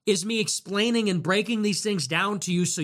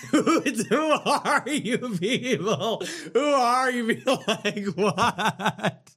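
laughing disbelief; 4 semitones higher, slower than usual. Real, not acted.

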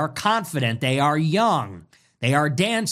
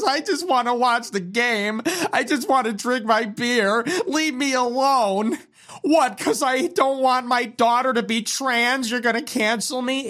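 high, whiny weepy voice; much higher, pleading crybaby whine; mock whining loser; 7 semitones higher than usual.

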